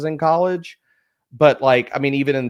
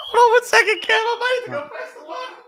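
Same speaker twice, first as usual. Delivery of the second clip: laughing; faster than usual. Real, not acted. Laughing, exasperated at son.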